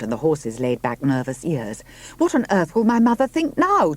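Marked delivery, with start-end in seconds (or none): 2.2-3.9 high, loud shout of dismay. A narrator's reading. Juno shouting at Robert about her mother, furious. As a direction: yell it out, shaking with rage. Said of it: "Juno shouted".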